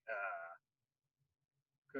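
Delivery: low, quiet, caught off guard; stunned. Retold or imitated. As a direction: lose your words completely. Stunned by recruiter's question.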